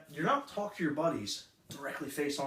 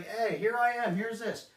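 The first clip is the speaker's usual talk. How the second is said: high, mock salesman opener; pushy introduction; 6 semitones higher, 3 dB louder, slower than usual. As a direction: imitate an overeager self-introduction. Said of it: "don't start off with saying".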